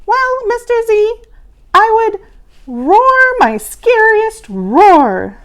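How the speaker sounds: a tiny monster proud of its roar; little monster voice. A narrator's reading. Small monster answering teacher.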